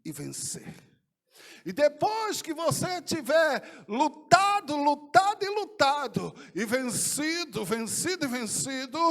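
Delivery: slow, emphatic commanding exhortation, rising to a loud call; Jesus telling the believer to fight and win. Retold or imitated. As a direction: urge the listener to fight on and win. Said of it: "ele me respondeu naquela visão assim".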